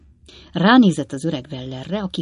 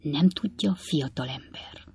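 whispering; 8 dB quieter than usual. A narrator's reading. Stiggins sounding out the young man, in a whisper. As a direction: drop into a soft whispered question. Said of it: "kérdezte Stiggins úr suttogva".

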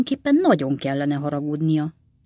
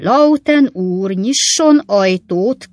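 loud, high, drawn-out call; stretched-out raised cry; 4 semitones higher, 8 dB louder, much slower than usual. A narrator's reading. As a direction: shout slowly for the clerk. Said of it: "kiáltotta a kis ügyvéd".

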